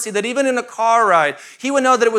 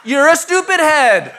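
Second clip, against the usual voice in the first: louder, slow, higher with laughter; laughing mock insult; 6 semitones higher, 6 dB louder than usual. Pastor repeating his toddler's insult to the congregation, laughing. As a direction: relish the silly insult while laughing.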